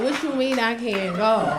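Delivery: slow, drawn-out mock protest; extra daughter. Daughter challenging the party ban, extra and drawn-out. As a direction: drag it out like an over-the-top daughter. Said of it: "she talks so extra".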